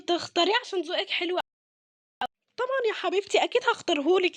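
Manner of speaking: ordinary delivery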